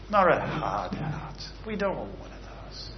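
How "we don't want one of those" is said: ordinary delivery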